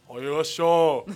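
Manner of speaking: loud norteño comedian yell; imitated shouting. Mimicking northern comedian friends, loud shouting. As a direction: call out loudly in norteño style.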